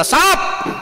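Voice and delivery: sudden high cry of snake!; frightened yell. Retold or imitated. Villager in the dark sees the rope and cries out in sudden fright. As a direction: yell it like seeing a snake at your feet. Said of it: "मोठ्याने ओरडला".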